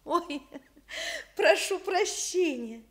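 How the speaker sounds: apologizing through a small laugh, slowly; a flustered laugh on the sorry; laughing apology. Acted. Old hostess to her young guests, laughing apologetically.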